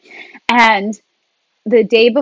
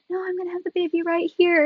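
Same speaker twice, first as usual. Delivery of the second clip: high, soft insistent quote; airy, softly protesting imitation; serene labouring woman; 8 semitones higher, 8 dB quieter than usual.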